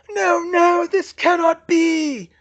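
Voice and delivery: high, loud, drawn-out villain scream; desperate, slow high scream. A narrator's reading. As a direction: scream in disbelief as you lose. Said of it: "he screamed".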